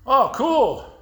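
high, loud mock-enthusiastic reply; upbeat approval. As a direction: quote a breezy, pleased reaction. Man's upbeat reply retold.

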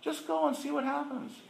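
soft coaxing counselor. Retold or imitated. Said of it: "She said".

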